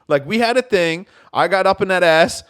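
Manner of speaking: hammed-up jerk voice; mock angry telling off. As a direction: dump her loudly and smugly. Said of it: "And I'm like".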